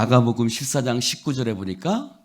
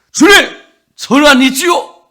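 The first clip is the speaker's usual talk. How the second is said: big confident shout; loudest voice; 13 semitones higher, 10 dB louder than usual. Acted. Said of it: "가장 큰 목소리로".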